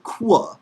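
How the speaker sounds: KWA with a w glide after the k; wrong sound demo. Shown as a demonstration. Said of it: "it's not a kwa sound".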